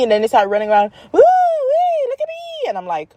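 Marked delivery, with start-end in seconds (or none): 1.1-2.7 put-on very high-pitched child whooping; imitated child's shrieky "look at me"; gleeful kid squeal. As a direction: squeal like a kid tearing round the house. Kids running around wildly, imitated.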